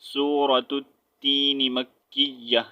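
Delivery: slow, linked recitation of the title; joined reading. Shown as a demonstration. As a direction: link the words and stretch them. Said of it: "اگر اس کو ملا کر پڑھیں گے". (ملا کر آہستہ پڑھنا)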